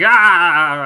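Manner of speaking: sudden loud startled "Ah!"; startled yell. Retold or imitated. Streamer reenacting himself in a highlight video, loud startled yell. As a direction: blurt out a short scream of fright. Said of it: "just going".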